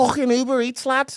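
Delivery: high, squeaky attempt at Scouse; Scouse accent. Shown as a demonstration. Showing co-host an accent, squeaky Scouse. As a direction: put on a squeaky Liverpool accent. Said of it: "that's my scouse".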